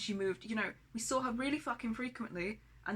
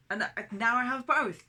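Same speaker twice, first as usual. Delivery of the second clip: loud, higher, half-laughing outburst; bitter laugh; 4 semitones higher, 9 dB louder than usual. Real, not acted. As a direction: let it burst out with a bitter laugh.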